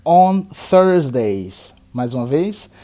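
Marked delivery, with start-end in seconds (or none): 0.0-1.7 very slow, drawn-out sentence, each word apart; very slow model sentence. Shown as a demonstration. Teacher models a sentence very slowly for repetition. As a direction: stretch every word out slowly. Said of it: "Eu vou falar sempre duas vezes cada frase".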